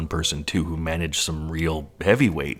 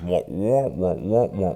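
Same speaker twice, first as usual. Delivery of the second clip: muffled droning wah wah; Charlie Brown teacher; slower than usual. Retold or imitated. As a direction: imitate voices dissolving into noise. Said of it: "sort of Charlie Brown's teacher".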